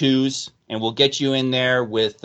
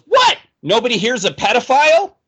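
high-pitched squeaky halfling outrage; indignant halfling; 8 semitones higher, 4 dB louder than usual. Acted. Halfling candidate protesting to the recruiters, high and indignant. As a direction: pipe up high like an offended little halfling.

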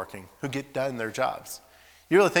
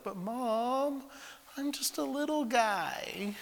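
put-on much higher, slow, babyish plea; little-kid voice; 13 semitones higher, much slower than usual. Little brother pleading with Mom to skip chores, babyish. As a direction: sound tiny and helpless. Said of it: "And he'd say it just like that".

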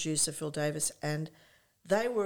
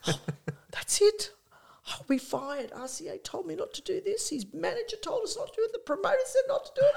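high, rising, faster and faster, ending in a laugh; panicked; 12 semitones higher than usual. Retold or imitated. Manager panicking he'll be fired, high and fast. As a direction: spiral into panic about getting fired.

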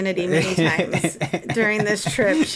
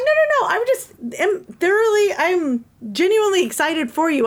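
higher-pitched, earnest retold refusal; retold excitement, voice lifted, slowing on the sincere part; excited for him; 6 semitones higher than usual. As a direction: wave it off, genuinely thrilled for the winner. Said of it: "I'm excited for him".

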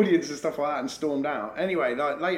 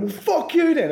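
imitating a furious heckler, much higher and louder; mock angry snap; 14 semitones higher, 7 dB louder, slower than usual. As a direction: spit it out like the offended drunk. Retells the heckler storming off.

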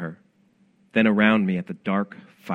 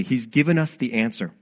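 ordinary delivery

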